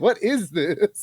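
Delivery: high, very slow, amused disbelief; baffled retold reaction. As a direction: draw each word out in mock bewilderment. Retelling stunned reaction to show.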